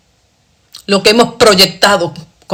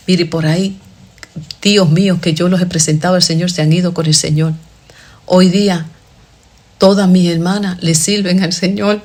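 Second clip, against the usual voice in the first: ordinary delivery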